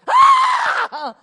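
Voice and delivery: very high, loud shocked "Ah!"; startled shriek. Acted. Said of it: "Zacchaeus goes".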